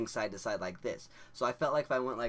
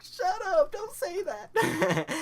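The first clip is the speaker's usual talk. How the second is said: squealed, much higher and louder, half laughing, half crying; laughing panic; 10 dB louder, much slower than usual. Retold or imitated. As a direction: shriek it in giggly panic. Panicking while high, laughing.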